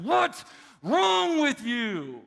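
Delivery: slow, high, loud angry shout. Retold or imitated. Father shouts at his daughter, retold, angry. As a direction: bark it right in her face. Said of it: "and I said".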